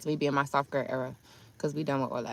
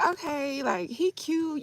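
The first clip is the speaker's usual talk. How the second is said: put-on high girly voice; 9 semitones higher, 5 dB quieter, slower than usual. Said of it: "But I was like".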